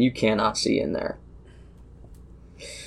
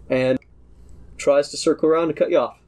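ordinary talk, nothing marked

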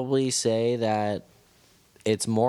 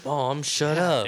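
higher-pitched mock kid protest; embarrassed kid; 6 semitones higher than usual. Kid to mom asking dumb questions in skate shop, mock-exasperated. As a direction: lightly play a kid hushing mom.

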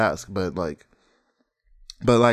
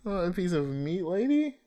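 high, softer pleading protest; mock indignation; 7 semitones higher, 4 dB quieter than usual. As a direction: play the offended man, pleading. Continues mock offended protest.

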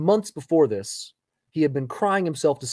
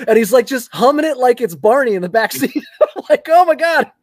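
laughing, louder and higher; cracking up mid-story; 8 semitones higher, 10 dB louder than usual. Real, not acted. Laughing about the son's backseat singing.

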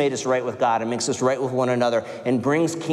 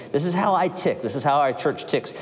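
ordinary talk, nothing marked

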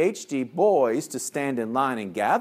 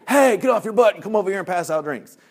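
loud, high angry call; screaming across a cafeteria; 6 semitones higher, 5 dB louder, faster than usual. Acted. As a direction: yell it across a big room. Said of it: "I didn't scream across the cafeteria".

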